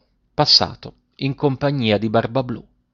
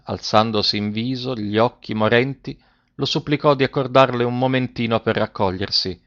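ordinary delivery, nothing marked

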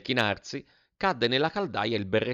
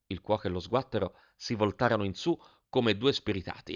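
usual speaking voice

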